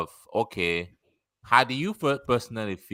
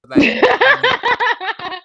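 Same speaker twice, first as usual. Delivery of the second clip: high loud laughing words; 9 dB louder, much slower than usual. Real, not acted. Asking the guest again while cracking up, laughing.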